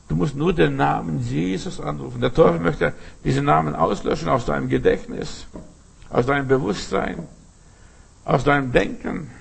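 ordinary talk, nothing marked

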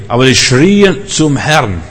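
SCHRIEN drawn out long; emphatic crying out. Real, not acted. Preacher on prophet and king crying to God, SCHRIEN stretched. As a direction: stretch SCHRIEN slowly and with force.

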